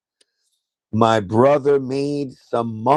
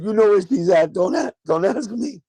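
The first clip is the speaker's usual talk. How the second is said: quoted a good bit higher; higher-pitched mock retelling of his own words; 6 semitones higher than usual. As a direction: replay it higher, teasing. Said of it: "I said".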